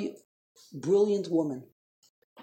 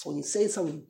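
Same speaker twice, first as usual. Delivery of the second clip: usual speaking voice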